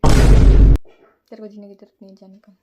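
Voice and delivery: mock rude brush-off; joking dismissal. Friends jokingly telling her to get lost, mock rude. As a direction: shoo her away harshly as a joke. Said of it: "अरे मजाक कर रही हूँ".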